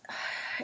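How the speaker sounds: soft, overwhelmed sigh; real sigh of disbelief. Real, not acted. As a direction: let out a quiet breathy sigh. Overwhelmed comparing past and present.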